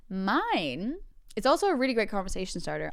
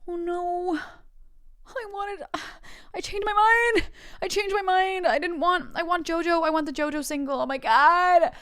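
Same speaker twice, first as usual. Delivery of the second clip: high, whiny wail of regret building louder; panicked child wail; 10 semitones higher, 5 dB louder than usual. Retold or imitated. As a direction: wail like a kid in a mock panic. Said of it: "I posted my letter and I went".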